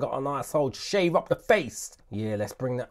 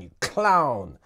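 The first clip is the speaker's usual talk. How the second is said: loud teasing put-down; mock insult; 6 dB louder than usual. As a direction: call him a clown, playfully loud. Mocking insult to sloppy beat.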